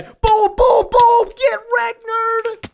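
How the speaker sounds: high, loud victory taunt; gloating taunt. Real, not acted. Gamer taunts the enemy he just beat, high and loud. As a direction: rub the win in, loud and high.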